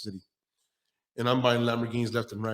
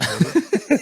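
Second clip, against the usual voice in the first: sassy honey; 13 semitones higher, 6 dB louder than usual. Retold or imitated. Mocking the doubter about money.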